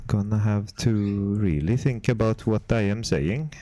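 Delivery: mock Swedish accent, words picked with care; careful chat in a badly faked Swedish accent; put-on Swedish accent, close on the mic; terrible Swedish accent. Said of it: "With Swedish accent".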